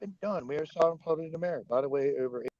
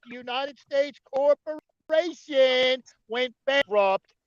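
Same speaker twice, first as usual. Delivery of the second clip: loud, high, angry lecturing; shouting down a caller, exasperated; heated rant; 7 semitones higher, 5 dB louder than usual. Real, not acted. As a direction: hammer every point home at Don.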